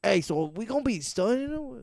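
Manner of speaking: high raspy rapper impression. Trying out a rapper's voice for the dog, high and raspy. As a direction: put on a high, raspy New York voice. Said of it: "sound like, uh, [name]".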